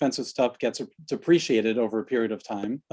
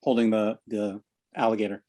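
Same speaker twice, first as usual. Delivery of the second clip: normal talking voice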